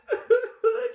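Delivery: loud, high pretend sobs; mock sobbing. Game master plays the inconsolable cleric, mock sobs. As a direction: break into hammed-up bawling. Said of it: "Just crying".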